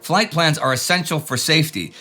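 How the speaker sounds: ordinary talk, nothing marked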